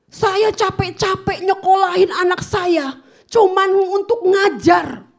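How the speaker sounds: slow, high, loud indignant shout; imitating a mother's angry shout. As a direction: yell like a furious mother.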